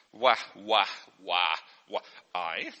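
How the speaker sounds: soft, low "wa, wa" sounded slowly; sounding out wa. Shown as a demonstration. Teacher sounding out the French spelling. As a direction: sound out "wa" softly while writing. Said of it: "the letter I would end with".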